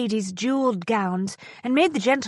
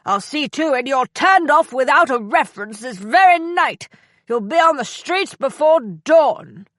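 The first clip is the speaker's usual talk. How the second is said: put-on furious drunk gentleman shouting through a door, much higher and louder; irate booming shout; 4 semitones higher, 5 dB louder than usual. A narrator's reading. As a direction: bellow it in outrage through the wood panels. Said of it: "An irate, albeit muffled, voice boomed".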